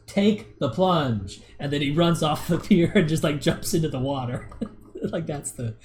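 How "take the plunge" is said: slow deadpan take the plunge; flat deadpan line to the lens, slow; deadpan to camera. Retold or imitated. Streamer quoting the YouTuber looking into the camera, slow deadpan. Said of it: "He looks at the camera and goes".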